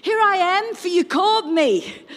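put-on high, loud child's voice; eager boy. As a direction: pipe up high and loud, reporting for duty. Voices boy Samuel running to Eli.

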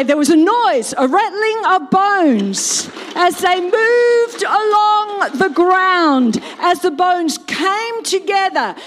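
loud, high, stretched-out storytelling; dramatic proclamation. A narrator's reading. Preacher retells the bones coming together, loudly dramatic. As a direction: project it big, stretching the movement words. Second, full-force telling after effect.

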